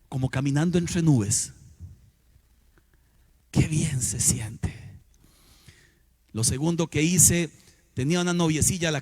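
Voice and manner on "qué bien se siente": slow hushed whisper, softer and lower; qué bien se siente, whispered slowly under the breath. Acted. Repeats it softly.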